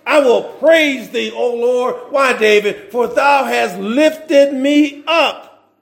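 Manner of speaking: slow, weighty scripture reading; real slow reading. Shown as a demonstration. Preacher rereading Psalm thirty for the church, slowly. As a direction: draw each word out. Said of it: "let me read that again real slow".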